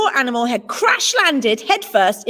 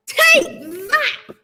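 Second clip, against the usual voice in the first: battle cry; 11 semitones higher than usual. A narrator's reading. Cub hurls stick at bear.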